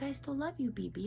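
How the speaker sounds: put-on high, soft child voice; lightly voiced sweet seven-year-old; little son comforting. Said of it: "He's like".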